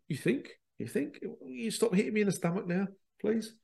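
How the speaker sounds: mock-annoyed, begging it to stop; exasperated retort. Retold retort to the table.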